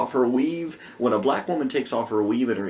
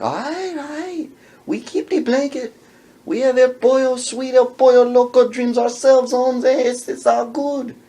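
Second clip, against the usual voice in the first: high placating Chicano voice; 10 semitones higher, 6 dB louder than usual. Retold or imitated. Man voiced.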